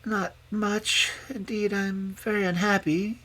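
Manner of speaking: high, tearful confession; tearful princess. A narrator's reading. Princess confessing unhappiness to the old dame, tearfully. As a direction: break towards tears as a high-voiced princess. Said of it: "bursting into tears".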